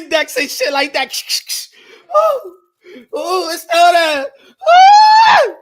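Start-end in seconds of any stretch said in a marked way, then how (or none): 2.1-5.6 loud, high horrified cries; disbelief. Retold or imitated. After wiping his phone, loud high horrified cries. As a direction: gasp out that it's still there. Phone screen still shows it.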